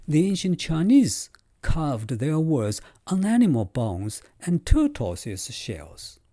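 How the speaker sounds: normal talking voice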